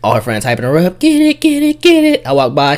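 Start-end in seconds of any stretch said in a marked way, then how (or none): 1.0-2.3 high-pitched mimicked cheering; hype-up cheer. Friends hype up a dancing girl, mock cheering. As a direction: imitate friends egging a dancer on, high and punchy.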